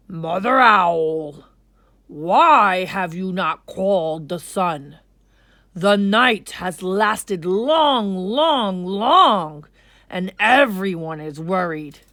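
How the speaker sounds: a commanding king asking solemnly; king's voice. Acted. King Lion questioning Mother Owl, slow and loud. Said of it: "King Lion asked".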